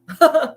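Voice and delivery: sudden high laugh, real and amused; high burst of laughter. Real, not acted. Reader laughing at her own dumb and dumber joke, a high laugh. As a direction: let a bright laugh pop out.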